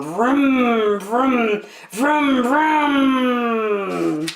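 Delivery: building engine noise, loudest on the last; engine vroom. Acted. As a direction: rev it up like a plane motor. Imitating plane engine starting.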